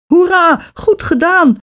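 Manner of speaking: put-on high-pitched jubilant boy; cheering. A narrator's reading. Hans congratulates his sister on beating the witch, cheering. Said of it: "juichte Hans".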